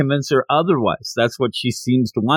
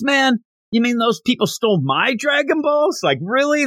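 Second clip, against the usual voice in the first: indignant; 9 semitones higher than usual. Retold or imitated. Yamcha indignant.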